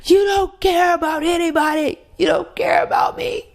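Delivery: high, strained yell, voice cracking up; angry outburst. Retold or imitated. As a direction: yell it at her from a hurt place. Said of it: "He was like".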